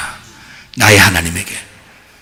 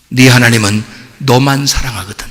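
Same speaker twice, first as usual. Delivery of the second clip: ordinary delivery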